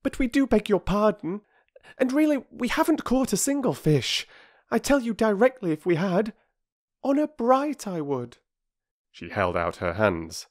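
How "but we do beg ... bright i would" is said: high, sincere schoolgirl; lightly voiced girl, pleading honesty; earnest girl. A narrator's reading. Bobby assuring the bargee they caught nothing, high and earnest. Girl's voice.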